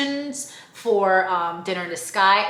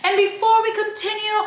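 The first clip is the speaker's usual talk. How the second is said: high, sing-song emcee call-out; host announcement; 11 semitones higher, 4 dB louder than usual. Retold or imitated. Staff announcing her graduation. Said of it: "they're like".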